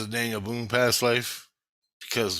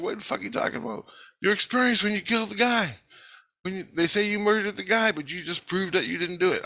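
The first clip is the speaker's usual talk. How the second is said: incredulous; 8 semitones higher, faster than usual. Retold or imitated. He retorts in confusion.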